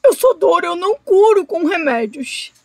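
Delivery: a touch of anguish in the alligator's thought; pained thought. A narrator's reading. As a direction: think it through the pain. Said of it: "ele pensou".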